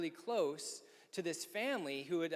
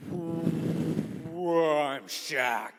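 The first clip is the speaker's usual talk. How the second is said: booming, louder drawn-out whoa; loud larger-than-life intro; big Shaq greeting; 8 dB louder, much slower than usual. Retold or imitated. Shaq greeting the small guy loudly, mock booming voice.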